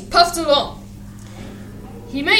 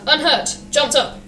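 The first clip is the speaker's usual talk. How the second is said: ordinary delivery, nothing marked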